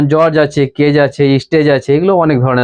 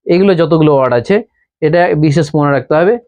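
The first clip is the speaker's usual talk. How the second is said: ordinary delivery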